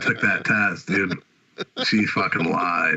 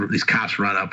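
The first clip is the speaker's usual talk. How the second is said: normal talking voice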